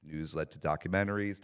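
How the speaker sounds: ordinary talk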